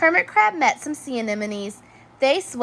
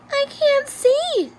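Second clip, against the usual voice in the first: much higher, slow little snail voice; slow, plaintive snail in the dark; worried snail; 3 dB louder, slower than usual. A narrator's reading. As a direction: drag it out like a small lost snail. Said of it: "said the snail".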